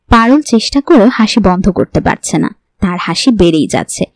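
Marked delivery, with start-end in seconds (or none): none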